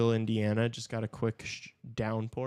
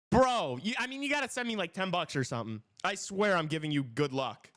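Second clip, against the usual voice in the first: hyped, higher pitched bro talk; excited, higher, still buzzing; frat bro; 6 semitones higher than usual. Real, not acted. Still hyped after the goal.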